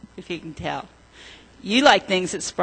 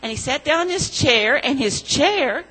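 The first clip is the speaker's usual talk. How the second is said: put-on storytelling to children; 5 dB louder than usual. A narrator's reading. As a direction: tell it like a children's story, leaning on each gold. Children's story told in church.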